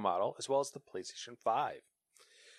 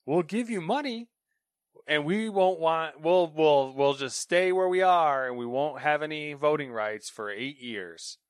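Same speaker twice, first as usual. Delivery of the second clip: louder, higher persuasive sales pitch, slowing down; lightly hammed-up dealmaker reassuring the family; mock slick company pitch; 3 semitones higher, 8 dB louder, slower than usual. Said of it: "And they're like".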